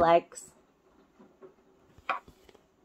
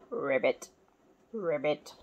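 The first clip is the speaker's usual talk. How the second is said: deep, soft croaking ribbit; frog croak; 8 semitones lower, 8 dB quieter than usual. Acted. Parent reading to child, low soft frog ribbit.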